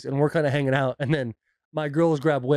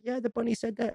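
high, softer mock-child whine; whining child; 8 semitones higher, 7 dB quieter than usual. Daughter answering dad about the bunny, imitated whine. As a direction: imitate a small girl insisting, high and whiny. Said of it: "the fucking whining voice".

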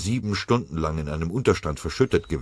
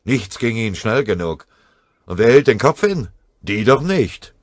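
lauter, grollender innerer Zorn; wütend; 4 semitones higher, 7 dB louder than usual. A narrator's reading. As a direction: sich in Wut hineinsteigern. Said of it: "dachte Scharf wütend". (Scharf wütend über die Vorgesetzten, laut)

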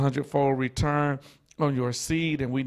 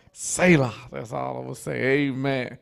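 normal talking voice

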